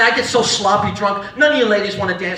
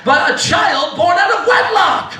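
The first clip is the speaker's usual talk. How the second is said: slow, swooping grandiose retort; mock outraged mother; 6 semitones higher, much slower than usual. Mother rebukes son for scolding her, hammed-up outrage. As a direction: draw it out, rising high in mock outrage.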